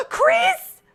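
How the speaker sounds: real delighted screams; excited screaming. Real, not acted. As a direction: scream for joy at spotting friends. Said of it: "Sorry if I'm screaming too much".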